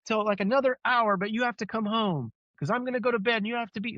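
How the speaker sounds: put-on high, pleading kids' voices then mom giving in. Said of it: "we'd go back down and be like".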